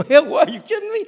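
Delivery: put-on a kid scoffing at the question, loud and high; incredulous kid. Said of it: "I said".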